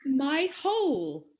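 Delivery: slow, sing-song riddle reading; drawn-out words, swooping up on the key ones; riddle recital. Acted. Quizmaster repeating a clue to puzzled friends, riddle recital.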